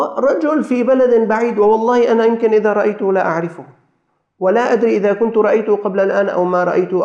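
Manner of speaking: high, insistent preacher's delivery; urgent address to a congregation; impassioned preaching. Real, not acted. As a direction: preach it with rising fervour, pitched high.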